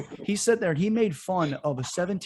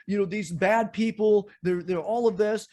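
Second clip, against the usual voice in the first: loud, higher hammed-up preachy mimic; mocking LeBron; 5 semitones higher, 4 dB louder than usual. Host mocking LeBron's police remarks, loud hammed-up mimicry. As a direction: ham up a self-righteous speech. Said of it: "He's like".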